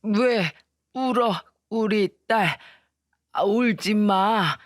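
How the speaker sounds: slow, gentle comfort from a frail mother; soothing old mother. Acted. Mother calming her sobbing daughter, slow and tender. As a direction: hush a crying daughter. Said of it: "엄마는 ... 우는 저를 달래주었어요".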